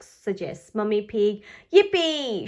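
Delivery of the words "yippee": high, loud cheer; excited shout. Acted. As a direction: cheer it out with glee. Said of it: "shouts Peppa".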